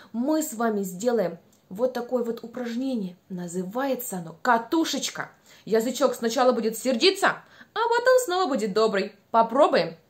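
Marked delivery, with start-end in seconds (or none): none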